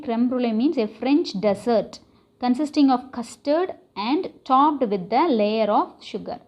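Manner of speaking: ordinary delivery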